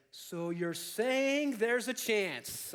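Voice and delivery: very slow, rising movie-quote delivery; mock dawning hope. Lloyd to the girl after a pause, slow mock dawning hope. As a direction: stretch it out, swelling with imitated hope.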